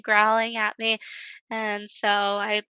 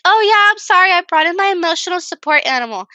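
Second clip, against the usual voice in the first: much higher and louder, quick and breezy; breezy client voice; 7 semitones higher, 8 dB louder, faster than usual. Retold or imitated. Waxer imitating the client excusing her dog, breezy and high. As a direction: play the carefree client tossing off an apology.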